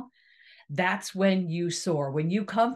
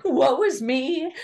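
mock whining; 5 semitones higher, 4 dB louder, slower than usual. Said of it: "sit there and".

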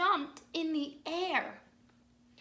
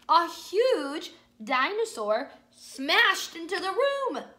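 loud, slow dramatic read; 5 semitones higher, 9 dB louder, slower than usual. Acted. Big dinosaur bursts in.

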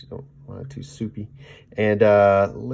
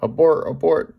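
mock alarmed viewer; 7 semitones higher, 4 dB louder than usual. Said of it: "A lot of you guys are gonna go".

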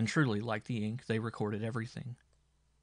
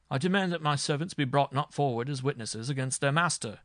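louder, higher indignant demand; storming; 4 semitones higher, 5 dB louder than usual. A narrator's reading. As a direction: rage at the judge as a lord. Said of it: "stormed the marshal".

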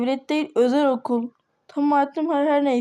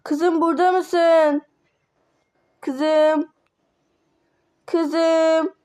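calling out; 3 semitones higher, much slower than usual. Acted. Parent calls for lost daughter.

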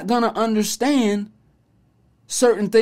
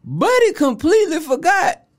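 incredulous; 7 semitones higher, 3 dB louder than usual. Retold or imitated. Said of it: "I'm like".

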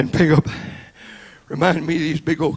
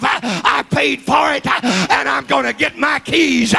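commanding Jesus; 6 semitones higher than usual. Acted. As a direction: pound on hell's door for the keys. Jesus demands keys at hell.

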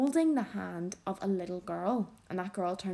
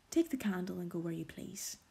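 muttered; 7 dB quieter than usual. A narrator's reading. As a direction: mutter it under the breath. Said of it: "muttered Heathcliff".

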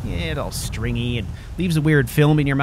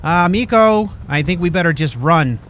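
loud, higher call to the companion; urgent call; 4 semitones higher, 7 dB louder than usual. Real, not acted. Player calling loudly to Miko to run from the fight. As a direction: yell at Miko to flee.

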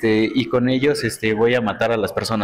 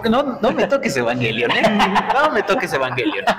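higher-pitched playful warning; mock protest; 7 semitones higher than usual. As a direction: jokingly defend a beloved show, voice lifted. Joking protest at criticism of Evangelion.

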